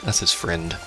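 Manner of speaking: ordinary talk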